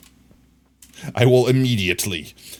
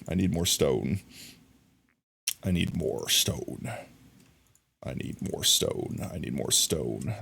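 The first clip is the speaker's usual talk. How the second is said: quieter each time down to a whisper; fading mutter; 7 semitones lower, 10 dB quieter than usual. Real, not acted. Muttering to herself while gathering stone, fading out. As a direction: let it trail off into a whisper.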